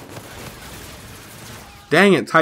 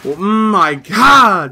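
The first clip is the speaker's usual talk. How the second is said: shocked; 8 semitones higher, 5 dB louder, much slower than usual. Real, not acted. Shocked by a death.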